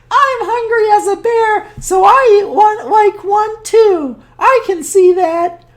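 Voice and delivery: put-on high, sweet old woman; cheerful high granny voice; old grandmother voice. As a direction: play the kindly old granny talking to her guest. High voice continues.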